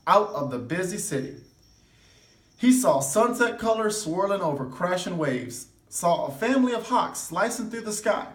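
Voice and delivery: ordinary delivery, nothing marked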